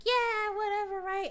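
high sarcastic yeah whatever; mock dismissive. Viewer scoffing at the guy's excuse, high sarcastic tone.

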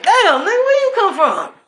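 high, loud comic outburst; startled disbelief. Acted. To a relative absent for decades, loud astonished outburst. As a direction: blurt it out in mock astonishment.

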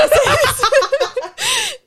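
high, breaking laugh; tearful laugh. Real, not acted. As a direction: laugh through the tears. Laughs after admitting tears.

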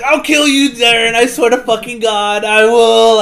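high, very loud hammed-up rage; acted furious threat. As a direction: shout a melodramatic vow of revenge. Acts Steve's threat of revenge.

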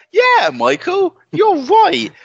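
high, loud and slow; high mock agreement. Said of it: "I'm like".

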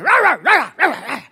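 high, loud yappy barks; dog barking. Retold or imitated. Pastor imitates his little dog barking at big dogs, high and loud. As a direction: bark like a little dog picking a fight. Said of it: "my dog is like".

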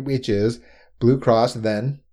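ordinary talk

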